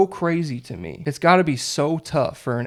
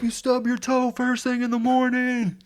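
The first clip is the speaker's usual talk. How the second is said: heckling the umpire; 11 semitones higher than usual. Retold or imitated. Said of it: "you yelling the most nicest insults".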